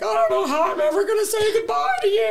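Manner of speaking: high, loud mock wail; hammed-up sob to a beloved dog; tearful goodbye. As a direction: rush it out in an exaggerated crying voice. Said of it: "You're crying".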